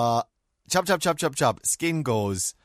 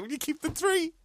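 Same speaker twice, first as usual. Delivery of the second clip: squeaky tip offer; 14 semitones higher, 4 dB quieter than usual. Retold or imitated. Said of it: "I was like".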